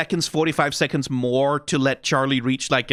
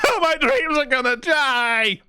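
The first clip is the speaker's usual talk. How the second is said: mock dramatic wail; 11 semitones higher, slower than usual. Mocking the song's dramatic moment.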